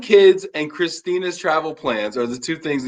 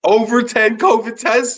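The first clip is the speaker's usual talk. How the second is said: higher, drawn-out incredulous question; real astonishment; 9 semitones higher, slower than usual. Real, not acted. Host hearing the guest's test count, slow high astonishment. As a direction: echo it back slowly, pitched up in disbelief.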